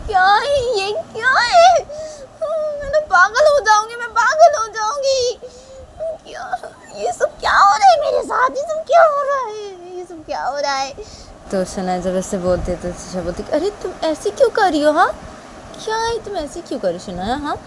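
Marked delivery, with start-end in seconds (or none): 0.1-10.9 very high, loud wailing; piercing, panicked character voice; hysterical Shanaya. Acted. Shanaya breaks down in confusion. 13.5-17.6 high-pitched remonstrating; high, worked-up girl character; upset Tisha. Acted. Tisha questions Shanaya.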